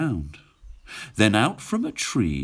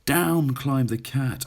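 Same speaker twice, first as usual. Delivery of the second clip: ordinary delivery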